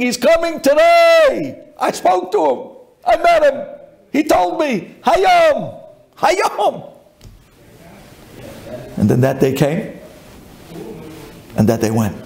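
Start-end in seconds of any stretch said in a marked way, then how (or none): 0.0-6.9 overjoyed cry. Acted. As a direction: shout the good news while dancing. Rabbi Yeshua dances, overjoyed.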